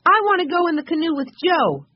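put-on very high, loud child's plea; excited cry. A narrator's reading. Benny begging to ride with Joe, excited high cry. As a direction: cry it out, bursting with eagerness. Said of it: "cried Benny, jumping up and down".

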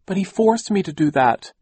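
high, loud whine; whining. A narrator's reading. Aunt excusing herself to the children, whining. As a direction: whine in self-defence, pitched right up. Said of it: "Aunt Josephine whined".

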